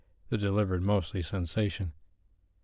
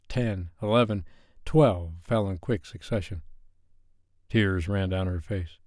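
ordinary delivery, nothing marked

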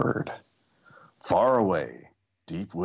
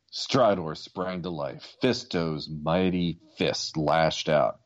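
plain reading voice

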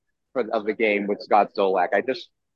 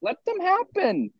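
much higher and slow, drawn out; pleading. Real, not acted. Pleading to announcers, slow and high-pitched. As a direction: beg them to just let it happen. Pleading to let moments happen.

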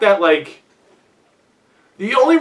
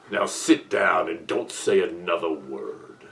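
low, slow, quiet stern command; stern deep voice; 10 semitones lower, 7 dB quieter, slower than usual. Retold or imitated. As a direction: lay down the law, low and slow. Quoting stern movie juror.

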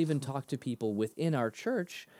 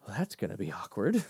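dry awkward aside; 3 semitones lower than usual. Retold or imitated. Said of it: "And I'm like".

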